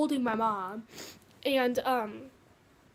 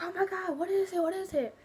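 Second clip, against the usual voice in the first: higher, frantic mom, repeating the question; panicked mom; 6 semitones higher, 3 dB quieter than usual. Retold or imitated. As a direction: voice the mom's panic in a high voice. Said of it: "my mom started freaking out".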